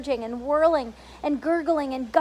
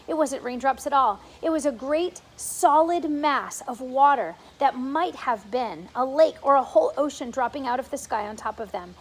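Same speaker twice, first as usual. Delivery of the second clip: ordinary delivery, nothing marked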